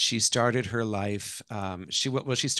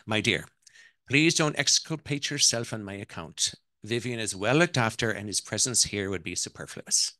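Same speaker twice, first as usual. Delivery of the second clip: put-on imperious Irish-accented matriarch; 4 dB louder than usual. Mother-in-law dismisses the wife's excuses, Irish accent. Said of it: "that's my Irish accent".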